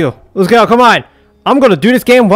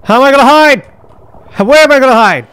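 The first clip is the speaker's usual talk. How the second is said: ordinary talk, nothing marked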